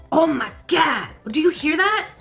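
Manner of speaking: ordinary talk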